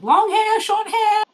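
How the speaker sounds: high, loud eager questions; excited. Real, not acted. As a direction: squeal the options out. Excited question about the cat.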